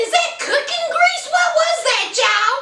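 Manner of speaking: incredulous question. Real, not acted. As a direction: squeak it out in total disbelief. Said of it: "I said".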